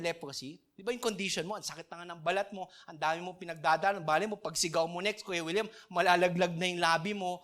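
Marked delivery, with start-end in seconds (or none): none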